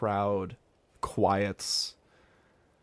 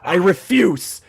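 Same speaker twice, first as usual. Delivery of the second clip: louder, higher protest; firm refusal; 6 semitones higher, 9 dB louder than usual. Acted. Eric loudly refusing to start peace with a fight. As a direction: refuse with force.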